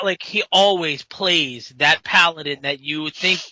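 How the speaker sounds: normal talking voice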